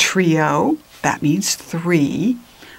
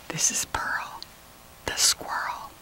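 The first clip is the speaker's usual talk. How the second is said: whispered, much softer; hushed aside; 14 dB quieter than usual. Shown as a demonstration. Reader introducing the squirrel to listeners, whisper. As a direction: lean in and whisper it.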